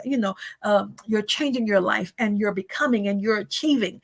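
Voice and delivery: usual speaking voice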